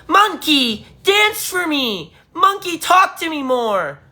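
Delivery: high-pitched heckling yell, drawn out; mock demanding yell. Streamer imitating fans who treat him as entertainment, mock yelling. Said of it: "I yell out sometimes like that loud".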